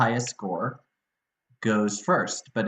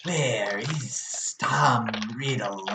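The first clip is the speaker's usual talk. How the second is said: slow, eerie Marilith voice; hammed-up villain asking slowly; slow monster voice; much slower than usual. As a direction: draw it out like a creepy monster. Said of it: "I had her go".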